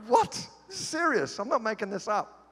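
high, disbelieving what? and serious?; incredulous surprise. Retold or imitated. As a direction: react like you can't believe it. Stunned reaction to bin story.

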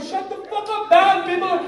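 high loud yell at the band. Retold or imitated. Dad on sinking boat yells at band, loud. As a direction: shout them down over the music. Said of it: "I'm like".

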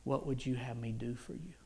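low, measured, gentle question; Jesus speaking. Retold or imitated. Jesus asking a desperate man what he needs, gently. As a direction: speak slightly low and unhurried, offering help. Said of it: "And he said".

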